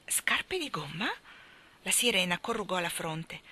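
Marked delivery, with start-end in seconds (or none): 0.1-1.1 whispered, slow and puzzled. Acted. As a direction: breathe the question in disbelief. Sirena perplessa chiede. (sussurro perplesso)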